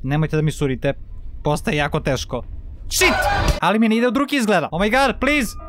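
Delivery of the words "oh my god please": ordinary delivery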